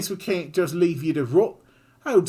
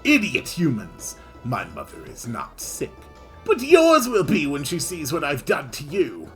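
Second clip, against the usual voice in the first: louder, snarling threat; griffin's rage; 5 semitones higher, 7 dB louder than usual. Acted. Griffin threatening the party, loud menace.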